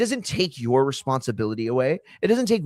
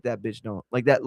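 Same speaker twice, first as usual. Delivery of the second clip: usual speaking voice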